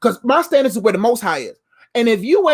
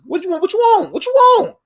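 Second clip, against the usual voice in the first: building demand; 8 semitones higher than usual. Acted. Repeated confrontational demand, building.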